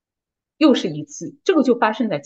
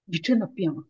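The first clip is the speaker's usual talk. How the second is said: soft, lowered quote; retold husband's remark; 4 semitones lower, 7 dB quieter than usual. Husband tells wife she changed, softly retold. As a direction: say it quietly, as if struck by it. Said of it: "我先生说".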